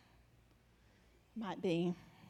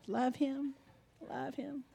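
ordinary delivery

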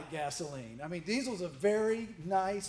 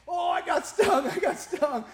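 high, louder, laughing mock panic; mock panicked yelling; 9 semitones higher, 5 dB louder than usual. Pastor imitating his stung brother, laughing mock panic. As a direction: cry out like you just got stung, half laughing. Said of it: "And he's like".

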